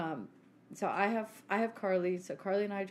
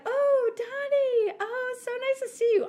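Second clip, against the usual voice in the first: much higher, slow syrupy hello; fake sweet greeting; 7 dB louder, slower than usual. Sister mimicking the host's sugary welcome to Dad. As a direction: gush over him sweetly, overdone. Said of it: "And she's like".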